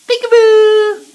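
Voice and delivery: high loud slow peekaboo call. Real, not acted. Parent plays peekaboo with the baby, high and loud.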